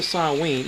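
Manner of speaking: Samhain said "sigh-WEEN"; real pronunciation. Shown as a demonstration. Reader tells listeners how to say it, Samhain as "sigh-WEEN". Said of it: "so pronounce it".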